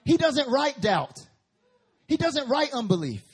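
loud, high punched declarations; preaching emphatically. Real, not acted. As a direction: hammer each line home.

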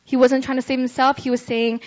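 usual speaking voice

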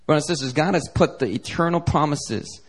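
usual speaking voice

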